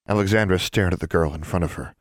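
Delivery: ordinary delivery, nothing marked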